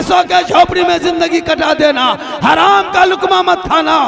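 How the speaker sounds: high, loud impassioned sermon delivery; full-force preaching. Acted. Preacher voices God addressing His servants, full-force exhortation. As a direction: thunder out God's command to His servants.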